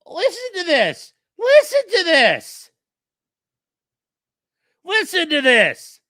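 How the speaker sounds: high, drawn-out disbelief; slow, high call to listen; incredulous exclamation. Real, not acted. Incredulous at lawyer on video.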